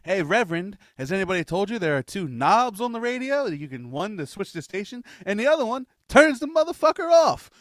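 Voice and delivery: loud, high building rant; mock preacher rant. Quoting a comedian heckling a reverend, loud build.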